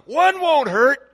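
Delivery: high, loud, stretched-out coaxing; coaxing tempter. Acted. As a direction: push a reluctant kid to give in. Acting a peer pushing a cigarette.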